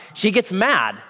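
ordinary talk

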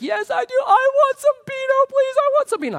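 put-on very high, loud little-kid pleading; squeaky toddler begging. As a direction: beg like a three-year-old wanting candy. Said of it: "He's like".

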